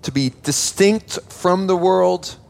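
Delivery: slowly stressing distinct from the world; slow emphatic point. Shown as a demonstration. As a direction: spell out point one slowly. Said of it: "We'll break that up into two points".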